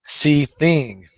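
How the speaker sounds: Seething called out loud with a long fall; Seething shouted out in full voice. Shown as a demonstration. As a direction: belt out Seething. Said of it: "You can say that a different way".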